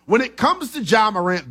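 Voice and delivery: ordinary delivery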